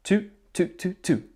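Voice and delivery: toot, toot, toot, toot high and clipped at the tongue; tongued toot. Shown as a demonstration. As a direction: tap out each toot. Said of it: "I'd like you to repeat after me".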